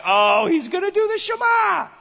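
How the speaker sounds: high, eager voice of the listeners; excited crowd. Retold or imitated. The crowd around Jesus, high and eager. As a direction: sound thrilled that the Shema is coming. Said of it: "they were all like".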